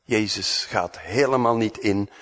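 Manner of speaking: ordinary delivery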